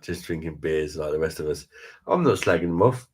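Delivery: ordinary delivery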